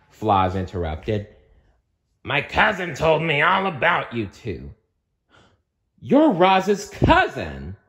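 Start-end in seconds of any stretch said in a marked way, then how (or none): 2.2-4.7 higher, bossy monster voice; strict monster manager voice. Acted. Floz character voice. 6.0-7.8 slow, much higher startled exclamation; alarmed exclamation, higher; exclaiming. Acted. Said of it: "Mike exclaimed".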